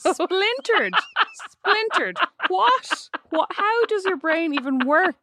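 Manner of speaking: high-pitched, teasing disbelief; incredulous ridicule. Real, not acted. Sister pounces on a wrong show title, incredulous. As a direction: pitch it way up, baffled by the blunder.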